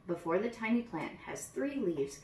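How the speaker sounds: usual speaking voice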